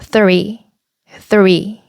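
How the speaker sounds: slow "three", tongue between the teeth; TH pronunciation demo. Shown as a demonstration. Teacher repeating three for learners, careful TH pronunciation. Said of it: "혀를 윗니와 아랫니 사이로 내밀어".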